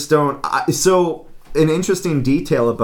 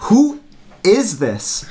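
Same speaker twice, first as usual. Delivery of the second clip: much higher, louder imitation of a baffled friend; insistent demand; 9 semitones higher, 3 dB louder, much slower than usual. Friend asking the caller a second time, insistent. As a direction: punch WHO, pause, then ask the rest. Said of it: "it was so strange".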